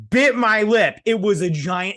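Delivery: suddenly high and loud on the bite; excited outburst. Real, not acted. Telling how an ant bit her lip, with loud excited pitch. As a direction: punch it out like the shock is fresh.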